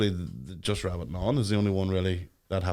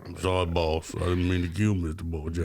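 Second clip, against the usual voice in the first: film character impression; 3 semitones lower than usual. Host parodies the Green Mile to co-host, film character impression.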